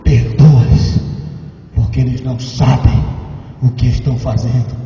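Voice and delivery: sudden drop to low, gentle forgiving plea; hushed compassionate plea; tender prayer. Acted. Jesus forgiving from the cross.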